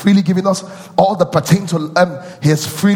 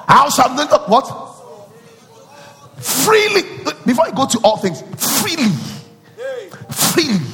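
preaching call and response; 10 semitones higher than usual. Acted. Preacher drawing out the answer.

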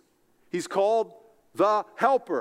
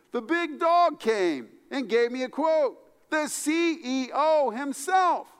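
excited; 11 semitones higher, 4 dB louder than usual. Retold or imitated. Excited retelling of CEO visit.

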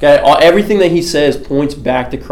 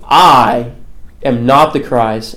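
"I" drawn out and set apart before a slow "am not the Christ"; stress on I; 2 semitones lower, much slower than usual. Retold or imitated. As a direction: lean hard on "I", pause, then finish slowly. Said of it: "this puts the emphasis on I".

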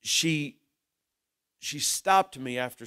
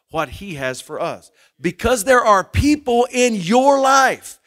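fervent preaching; 3 semitones higher, 7 dB louder than usual. Acted. Preaching at full force.

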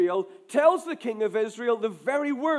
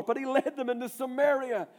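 ordinary delivery

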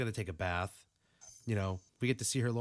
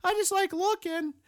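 high, louder mock creep; creepy brother voice; 6 dB louder than usual. Retelling the brother's excuse to the cohost, high mock whine. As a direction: whine it like a pervy boy. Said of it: "he was like".

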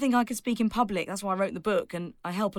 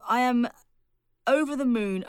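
normal talking voice

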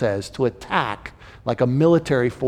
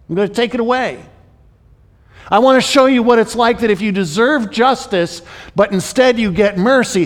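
higher, louder, driving God voice; intense God speaking; 5 semitones higher, 3 dB louder than usual. Retold or imitated. God pressing Jonah to feel the lesson, higher and louder. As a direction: press the lesson home, rising in force.

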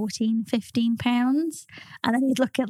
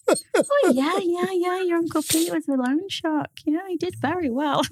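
mum casual brag; 8 semitones higher than usual. Retold or imitated. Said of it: "it's like".

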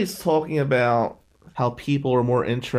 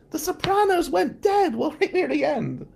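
much higher imitated aunt, outraged; aunt on the phone. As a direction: squeak out the aunt's panicked question, high up. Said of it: "She goes".

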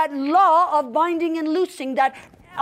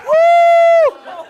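very high, loud held "woo"; excited whoop; 11 semitones higher, 10 dB louder than usual. Real, not acted. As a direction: let out an exultant preacher's whoop. Preacher whoops after climax.

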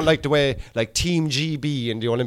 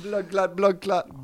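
higher mock Jamaican accent; Caribbean accent; 4 semitones higher, 5 dB quieter, slower than usual. Comic milking the joke, Caribbean accent. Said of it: "very Caribbean towards the end".

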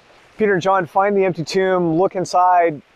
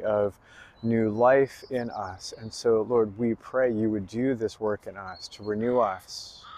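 prayer; 5 semitones lower, 5 dB quieter than usual. Real, not acted. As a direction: pray aloud, lower and unhurried, phrase by phrase. Leads the congregation in prayer.

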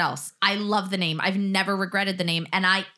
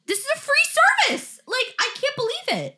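amazed. Real, not acted. Amazed at free story hour.

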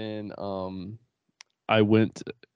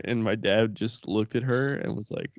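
usual speaking voice

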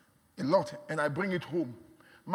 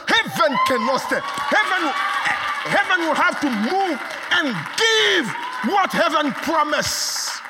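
preaching at full force; 8 semitones higher, 7 dB louder than usual. Acted. Preacher telling the church heaven must move, shouting. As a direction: shout the promise out with fire. Preaching climax on acting faith.

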